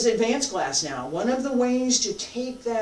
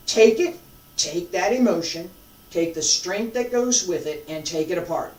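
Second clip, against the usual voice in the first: normal talking voice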